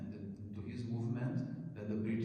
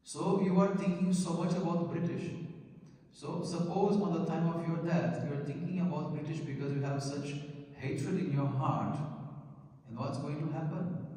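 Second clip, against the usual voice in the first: higher, louder preaching with rising force; fiery preaching; 6 semitones higher, 4 dB louder than usual. Acted. Preacher warning the audience about hatred, fiery and loud. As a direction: press the point hard on the listeners.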